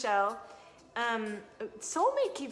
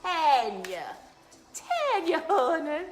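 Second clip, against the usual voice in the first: put-on loud, lifted old Southern drawl; old Southern accent; 8 semitones higher, 7 dB louder, much slower than usual. Reader calls a viewer honey, old Southern accent. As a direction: call out like an old Southern lady. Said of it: "When I do this old Southern accent".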